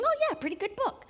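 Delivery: imitating a high, fast, offhand reader; casual shrug. Friend's thought on reading the Iliad in translation, offhand and high. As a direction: toss it off like it's no big deal. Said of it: "and thinking".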